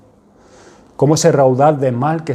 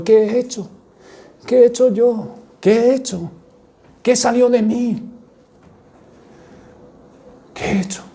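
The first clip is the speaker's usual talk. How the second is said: higher, a mild anguished cry; anguished lament; 9 semitones higher than usual. Acted. Peter weeping alone after denying Jesus, anguished. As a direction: ask yourself in dismay what you did.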